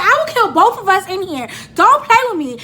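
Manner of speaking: high, loud outburst; hammed-up threat. Passenger grabbing the wheel from driver, dramatic shouted threat. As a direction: threaten wildly, playing up the fury.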